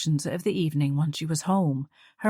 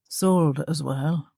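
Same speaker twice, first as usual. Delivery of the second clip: put-on cultured Belfast accent; 2 semitones lower, much slower than usual. A narrator's reading. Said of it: "He had a Belfast accent, cultured".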